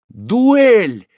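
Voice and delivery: high, loud gasp of a word; stunned outcry from the crowd; astonished exclamation. A narrator's reading. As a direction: guests shocked at the proposal, exclaiming. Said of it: "воскликнули многие с изумлением".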